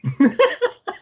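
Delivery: very high squealing burst of laughter; high delighted laugh shriek. Retold or imitated. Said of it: "And I'm like".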